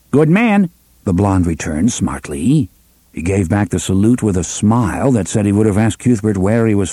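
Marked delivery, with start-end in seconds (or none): 0.1-0.7 high, crisp Nordite reply; smart reply. A narrator's reading. Picket answers the salute, high and smart. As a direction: snap back the salute cheerfully.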